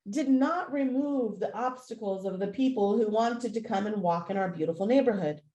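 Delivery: ordinary talk, nothing marked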